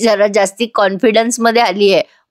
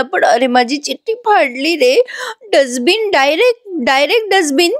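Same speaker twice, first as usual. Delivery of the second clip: imitating a sobbing wail, rising higher on each word; mock sobbing; 7 semitones higher than usual. Heartbroken Arjun wails to his friend about the torn letter, mock sobbing. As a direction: act out a heartbroken man's teary complaint.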